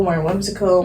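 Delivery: ordinary delivery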